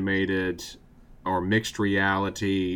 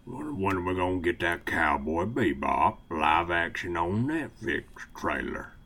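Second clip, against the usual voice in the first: put-on Southern accent; 4 semitones lower, 6 dB quieter than usual. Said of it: "Southern accent segment".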